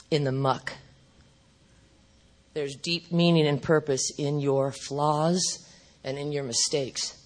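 low, soft, measured delivery; quiet, grave reassurance; solemn message. Retold or imitated. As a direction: let it land gently, with long pauses. Solemnly relays message received from God.

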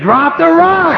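high, loud shout; shouted command. Retold or imitated. Everybody above calling down to him, loud shout. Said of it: "They said".

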